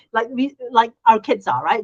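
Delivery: ordinary delivery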